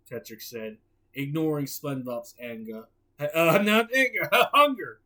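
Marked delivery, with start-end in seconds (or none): 3.2-5.0 laughing correction. Real, not acted. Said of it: "Why am I saying wrong words".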